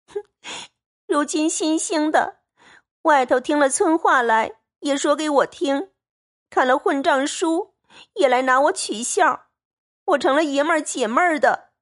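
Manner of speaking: put-on a wronged girl protesting through tears; tearful complaint. A narrator's reading. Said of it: "黛玉便哭道". (带哭腔、音调拔高的委屈控诉)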